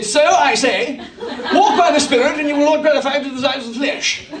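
mock-proper English reading of scripture; clipped English accent. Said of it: "in his best clipped English accent".

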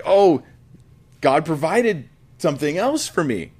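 high, bright Oh of discovery; surprised relief. Retold or imitated. Abraham spotting the ram in the thicket, surprised relief. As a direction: gasp at the answer, then relief.

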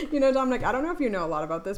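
moqueur, fast and amused, laughs through it; laughing. Real, not acted. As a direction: tease him while laughing. Teasing after the slip.

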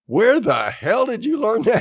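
high, astonished question; staring in disbelief at a teammate's skill; amazed. Retold or imitated. Woman amazed by a soldier's shooting, high astonished question. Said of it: "looking at him like".

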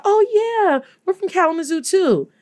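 high-pitched "oh, yeah" imitation; wife's friendly reply. Wife telling a fan where they are from, high friendly voice.

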